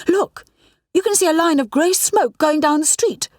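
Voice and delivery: high eager look, pointing it out; urgent thrilled discovery; excited pointing. A narrator's reading. Rani points out the smoke trail, excited and high.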